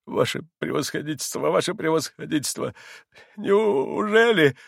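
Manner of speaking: high, halting plea of a crushed man; desperate, faltering begging; frightened pleading. A narrator's reading. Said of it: "Лицо бедняка было убитое, взгляд испуганный".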